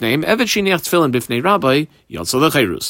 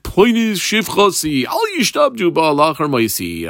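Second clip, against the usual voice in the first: dying master; 4 semitones higher than usual. Acted. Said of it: "he was on his deathbed".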